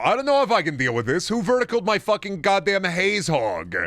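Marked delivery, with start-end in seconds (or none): none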